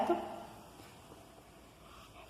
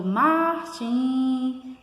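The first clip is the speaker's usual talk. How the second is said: "Martin" drawn out like a hail; demonstrative calling of "Martin", syllables stretched; calling out a name; 4 semitones higher, 3 dB louder than usual. Shown as a demonstration. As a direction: call "Martin" with long, swelling vowels. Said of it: "quando você faz esse chamamento".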